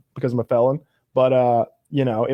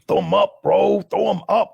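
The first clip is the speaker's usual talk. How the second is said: mock fight challenge; 6 semitones higher than usual. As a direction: raise the pitch, imitating a prison challenge. Retelling cellmate's challenge to fight.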